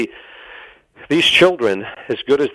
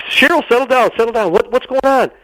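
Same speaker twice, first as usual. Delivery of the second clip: high, loud call to settle her down; pitched up, pleading for calm; urgent calming; 10 semitones higher, 3 dB louder than usual. Retold or imitated. Man on the phone urging his sobbing secretary to calm down, high and urgent.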